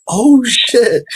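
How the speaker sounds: high startled oh shit; caught-out surprise. Retold or imitated. American realizing the man is British, high startled. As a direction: squeak it when caught out. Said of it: "I'm like".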